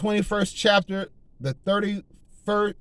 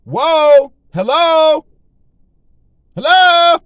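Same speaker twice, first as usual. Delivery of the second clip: emphatic exclamation; 9 semitones higher, 13 dB louder, much slower than usual. Real, not acted. Preacher stressing take heed.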